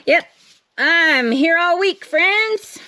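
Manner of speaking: cheesy mock comedian closing line. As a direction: play it like a lounge comic milking the punchline. Comedian punchline joke.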